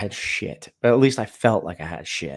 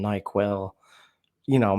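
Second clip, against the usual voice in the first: ordinary delivery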